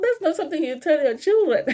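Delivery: high-pitched, amused scolding; mock disapproval. Reacting to a dad insulting his kids, amused disapproval.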